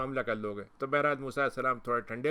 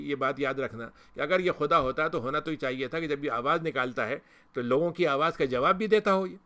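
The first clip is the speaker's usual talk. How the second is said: normal talking voice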